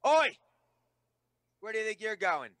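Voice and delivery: sharp challenge, voice up high; guard calling out. Acted. Town guard barking at the traveller to halt. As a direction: stop the stranger cold. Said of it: "looks at you and goes".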